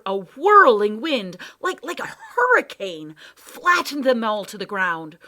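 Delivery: loud, surging storytelling; dramatic narration. A narrator's reading. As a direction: ride the storm's force. Narration of hurricane swell.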